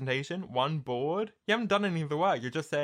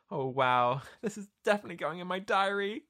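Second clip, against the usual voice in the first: quick, higher, gushing quote; mock gushing Ashley; 7 semitones higher than usual. Ashley gushing to her date about the helicopter, mock giddy. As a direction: imitate her giddy overexcitement. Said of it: "And she goes".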